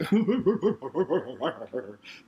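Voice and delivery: imitated cackling of laughing relatives; long, rolling pretend belly laugh; mock raucous laughter. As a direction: mimic a roomful laughing at you. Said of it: "like, raucous laughter".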